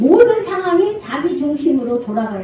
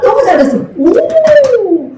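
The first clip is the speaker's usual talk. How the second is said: put-on high, louder praise for the child; 7 semitones higher, 5 dB louder, slower than usual. Mom praising her child's effort, high and louder. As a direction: cheer the kid on.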